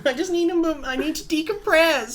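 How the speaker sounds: put-on laughing whiny child voice; whining kid. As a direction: whine like a child dragged to school. Still in the kid voice.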